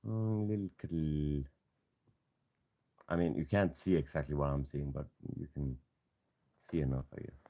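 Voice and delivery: soft hushed voice. Real, not acted. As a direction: talk low beside the cat. Cuddling the sleepy cat.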